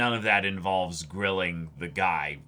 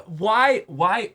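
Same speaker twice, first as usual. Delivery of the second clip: exasperated why; 10 semitones higher, 5 dB louder, much slower than usual. Acted.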